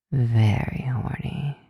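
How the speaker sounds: slow, low whisper; seductive whisper.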